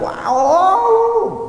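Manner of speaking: long, very high "wow" howl; exaggerated wail for comic effect; mock raging howl. Shown as a demonstration. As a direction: let out a drawn-out, high-pitched mock howl. Mimics raging person, hair wild.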